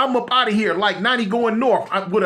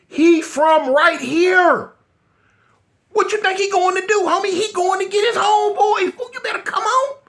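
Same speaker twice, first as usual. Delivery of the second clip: urgent shouting; 9 semitones higher than usual. Retold or imitated. Urging Keith to leave before the homeboys come, frantic shout. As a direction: yell at him to hurry before trouble comes.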